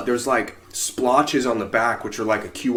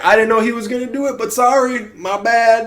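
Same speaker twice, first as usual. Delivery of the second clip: mock apology; 12 semitones higher, 5 dB louder than usual. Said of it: "some fucking sorries from them".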